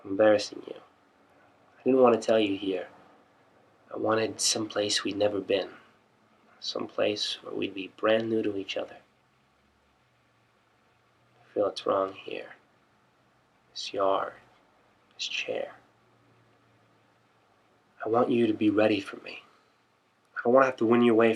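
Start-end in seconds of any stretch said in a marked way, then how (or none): 3.9-15.7 hushed, halting confession of love; soft confession. Acted. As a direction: confess it softly, in broken-up phrases.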